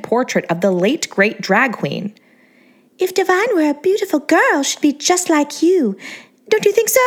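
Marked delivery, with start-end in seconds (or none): 3.0-7.0 put-on high, sweet baby-doll voice; bubbly celebrity voice, pitched way up; Angeline's girly voice. A narrator's reading. To the narrator, a high, sweet starlet voice.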